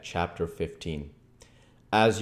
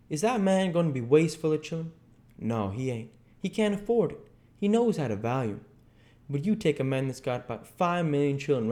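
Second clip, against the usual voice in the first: higher, animated dialect argument; Jim, worked up; 7 semitones higher than usual. Acted. Jim arguing Solomon with Huck, worked up. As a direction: argue it in Jim's dialect, pitched up.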